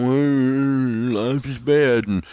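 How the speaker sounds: exaggerated woe-is-me complaining; pickle-faced moaning. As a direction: moan slowly like a hammed-up sad sack. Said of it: "sit around going".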